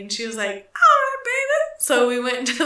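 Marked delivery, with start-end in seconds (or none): 0.8-1.8 high, loud imitation of a boisterous old lady; older smoker impression. Older coworker agreeing to help, imitated in a high, loud voice. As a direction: mimic a smoker coworker, pitched way up. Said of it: "That's what she sounded like".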